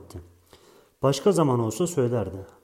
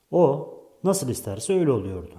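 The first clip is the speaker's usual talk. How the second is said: usual speaking voice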